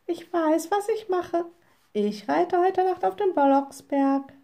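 high-pitched, determined witch; defiant little witch. A narrator's reading. Witch defiantly announces her plan.

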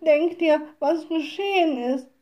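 high, mildly tearful lament; lightly acted distressed women; sobbing. A narrator's reading. Women tell the little witch their trouble, mildly tearful. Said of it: "schluchzten die Weiber".

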